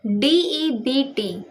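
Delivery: letters one by one, slowly; spelling out letters. Shown as a demonstration. Teacher spelling the word for students, slow. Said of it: "अगला word है डेट".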